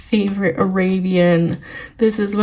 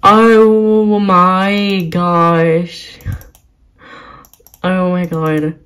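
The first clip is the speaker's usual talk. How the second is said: freaking out; 4 dB louder, much slower than usual. Real, not acted. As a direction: stretch every word out in overwhelmed excitement. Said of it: "I'm, like, freaking out right now".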